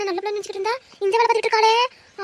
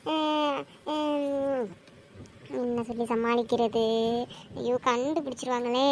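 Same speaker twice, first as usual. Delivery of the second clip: softer, muttered panic; nervous aside; 4 semitones lower, 6 dB quieter, slower than usual. Acted. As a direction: mutter to yourself, cornered. Panicked aside while lying.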